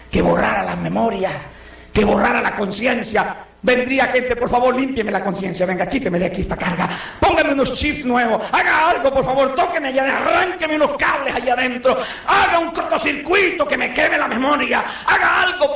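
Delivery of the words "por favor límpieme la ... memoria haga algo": rising to a higher near shout; frantic pleading. Retold or imitated. A guilty person begging the inventor to erase memory, building to a shout. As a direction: beg more desperately line by line. Imagined customer begs memory wipe.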